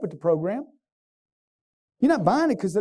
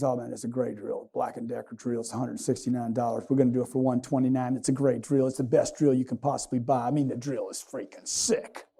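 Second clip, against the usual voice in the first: lower, much softer salesman patter, lightly voiced; pushy drill salesman; 7 semitones lower, 5 dB quieter than usual. Home Depot salesman pitching a drill to a customer, low hushed patter. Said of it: "guy says".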